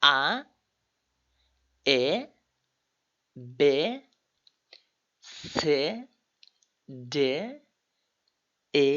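each German letter spaced out with pauses; alphabet drill. Shown as a demonstration. Teacher revising the German alphabet, slow drill. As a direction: recite the alphabet slowly for learners. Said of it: "আবার revision দিয়ে দিই".

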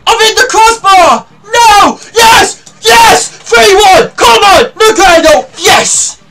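very high, loud celebration yells; ecstatic shouting. Real, not acted. Gamer screaming as his team scores, ecstatic. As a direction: scream it out in joy. Shot hits crossbar then scores.

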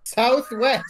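incredulous echo. Real, not acted. Echoing the absurd move.

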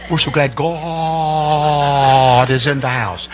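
very slow, syrupy pulpit voice; preacher talk. Retold or imitated. Mocking stuffy preachers for the crowd, drawn-out preacher voice. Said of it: "It's called preacher talk".

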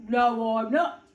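loud big-bear denial; booming storybook bear voice; indignant bear. A narrator's reading. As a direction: deny it firmly as a big bear. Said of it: "said the bear".

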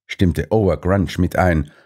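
usual speaking voice

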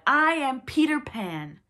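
high, loud, drawn-out proclamation; proud cry. A narrator's reading. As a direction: proclaim your name triumphantly. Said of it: "he cried".

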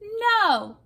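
high squeaky puppy voice; a small puppy's stubborn little no; yappy panting puppy. Acted. Puppy refuses the dogs in story.

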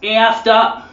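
high, loud American "After", flat A with R dropped; mock American straining to say "After"; failed American attempt.

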